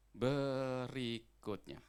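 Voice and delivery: very slow, stretched "Berikutnya"; drawn-out announcement. Real, not acted. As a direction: draw each syllable of "Berikutnya" out long. Stretched announcement, then repeated normally. ("Berikutnya" diucapkan panjang mengulur)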